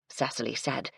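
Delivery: usual speaking voice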